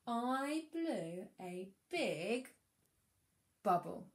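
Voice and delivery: "I blew a big bubble" drawn out very slowly; slow, stretched model reading. Shown as a demonstration. Teacher reading her sentence slowly to the class. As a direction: read it word by word for a child to follow. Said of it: "Here's my sentence".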